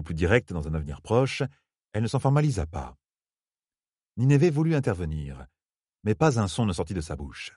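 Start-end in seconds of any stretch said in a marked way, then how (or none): none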